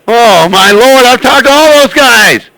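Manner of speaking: loud, thrilled exclamation; excited. Real, not acted. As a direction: burst out with delight. Excited reply to friends' names.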